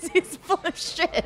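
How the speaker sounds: high, slow, drawn-out disbelief; mock-aghast, stretch every word; incredulous scoff. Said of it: "And I was like".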